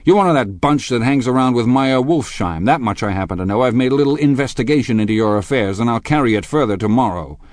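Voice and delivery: loud, high, driving attack; angry accusation. A narrator's reading. Tom exposes Gatsby's shady connections, accusing angrily. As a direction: accuse him with growing momentum.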